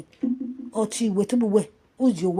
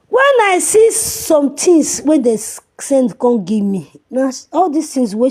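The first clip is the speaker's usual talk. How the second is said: angry outburst; 5 semitones higher, 8 dB louder than usual. Real, not acted. Outraged rant.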